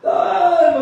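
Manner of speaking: high, loud, stretched call. Acted. Mother calls daughter who ignores her, calling out.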